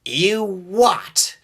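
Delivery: high, shocked question; outraged disbelief. A narrator's reading. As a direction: react high and appalled. James outraged at Duck's confession.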